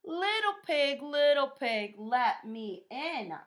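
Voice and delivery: high, loud bellowing wolf voice; wolf demanding entry in a booming voice. A narrator's reading. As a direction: bellow it like the big bad wolf at the door. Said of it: "bellowed the wolf".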